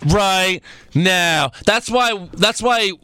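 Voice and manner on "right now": very slow, stretched-out delivery; mock announcement. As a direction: drag out each word like a wrestling announcer. Mimics drawn-out match-start announcement.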